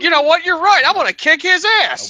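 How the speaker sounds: high, loud hammed-up resolve; rally yourself to attack; mock fired-up yell. Tripping narrator deciding to kick the tree, mock yell.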